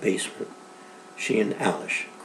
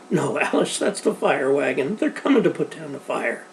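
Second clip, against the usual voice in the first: ordinary delivery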